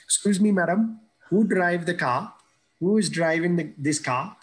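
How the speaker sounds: a boy's Indian accent, lightly imitated; imitating Indian accent. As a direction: retell his younger self with an Indian accent. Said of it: "I had an Indian accent at the time".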